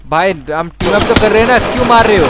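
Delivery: rising to a loud, high complaint; indignant protest. Real, not acted. Protests being shot while teaming up.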